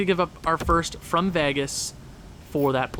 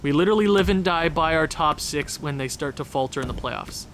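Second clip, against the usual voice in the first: normal talking voice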